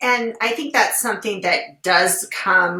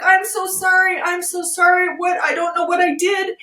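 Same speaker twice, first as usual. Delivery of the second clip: tearful apology; 10 semitones higher than usual. Retold or imitated. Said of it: "she started to cry".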